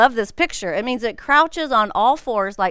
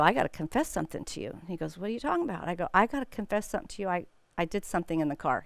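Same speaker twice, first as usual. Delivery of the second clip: quiet confession; 4 semitones lower, 10 dB quieter, much faster than usual. Retold or imitated. Confessing to husband after incident.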